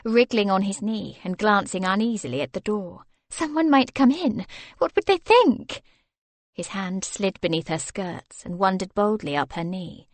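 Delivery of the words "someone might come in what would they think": put-on higher, anxious young lady; worried someone will walk in; flustered wife. Wife fears being caught on his lap, higher and anxious.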